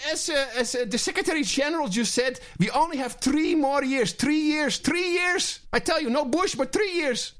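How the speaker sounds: put-on high-pitched, alarmed European accent parody; hammed-up European accent, insisting on the deadline; mock European accent. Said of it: "Your accent is great".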